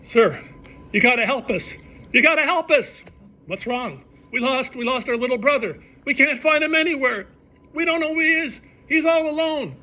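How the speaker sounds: high, hammed-up desperate plea; mock panic. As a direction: beg the clerk in fake distress.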